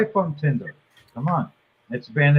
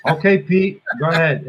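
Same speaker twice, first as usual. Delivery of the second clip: ordinary delivery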